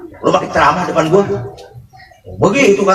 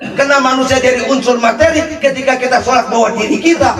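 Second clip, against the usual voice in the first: normal talking voice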